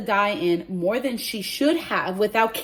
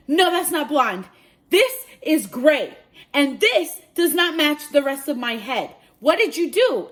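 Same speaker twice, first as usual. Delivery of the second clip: loud, raised, emphatic retort; indignant confrontation; 6 semitones higher, 6 dB louder than usual. Retold or imitated. Client disputes hair color with hairdresser, indignant. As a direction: retell telling someone off, punching key words.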